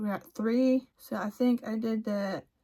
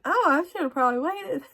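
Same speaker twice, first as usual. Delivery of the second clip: higher, louder retold dismay; sheepish realisation; 7 semitones higher, 5 dB louder than usual. She bought girl clothes too soon, sheepish surprise. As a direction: pitch it up as a sudden realisation.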